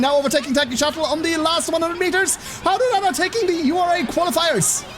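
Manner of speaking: high-pitched race-commentator climax; excited finish call. Real, not acted. Streamer calling Haru's winning overtake, excited finish call. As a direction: voice soaring as the horse overtakes.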